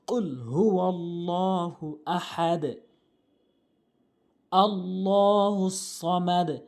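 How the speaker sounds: slow, measured Arabic recitation with long held vowels; Quran recitation. Shown as a demonstration. Teacher reciting the sura correctly for learners, slow recitation. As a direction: recite slowly, drawing out each long syllable.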